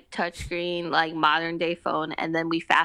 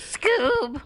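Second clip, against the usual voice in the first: high Scooby-Doo bark of the name; 11 semitones higher than usual. Shown as a demonstration. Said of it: "I tried to do a Scooby-Doo accent".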